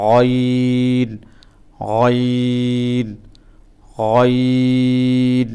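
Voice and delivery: গইন drawn out slowly and heavy; thick ghain. Shown as a demonstration. Teacher demonstrating Arabic ghain, slow and thick. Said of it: "একটু মোটা করে গ".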